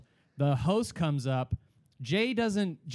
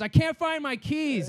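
put-on drunk whine; 10 semitones higher, 3 dB louder than usual. Drunk friend lost keys.

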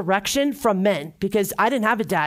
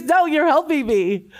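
higher, louder, slower, laughing insistence; laughing mock protest; 7 semitones higher, 4 dB louder than usual. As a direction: playfully argue back at her mentor. Retold protest to her mentor.

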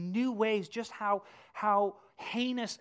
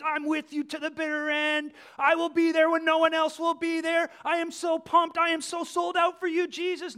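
higher, louder, boastful pledges; grandiose bravado; 8 semitones higher, 8 dB louder than usual. Retold or imitated. Peter's old boasts to Jesus, higher and louder. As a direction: puff up and brag your loyalty. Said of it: "All these grandiose claims".

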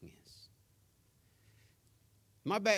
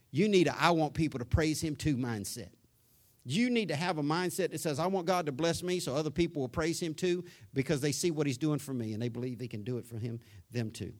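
loud, driving preacher cadence, voice pushed up high; preaching at full force; 3 semitones higher, 5 dB louder than usual. Real, not acted. Preacher urging his congregation to praise God, full-force preaching. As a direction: hammer the point home to the pews.

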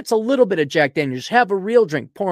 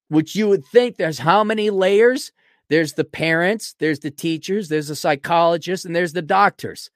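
ordinary talk, nothing marked

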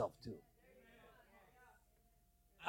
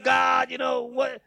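loud and slow; shouting at God; 6 semitones higher, 8 dB louder, slower than usual. Retold or imitated. Pastor retelling how he shouted at God, loud. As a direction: call God out, fed up. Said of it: "I'm like".

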